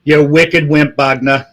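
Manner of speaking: hammed-up Boston tough talk, dropping the R in Bogner; Boston accent. Shown as a demonstration. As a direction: call out mockingly in a thick Boston accent. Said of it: "in a Boston accent".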